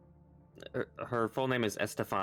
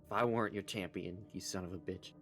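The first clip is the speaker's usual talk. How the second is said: muttered curse; 2 semitones lower, 7 dB quieter, faster than usual. Acted. Halfling curses patron under breath.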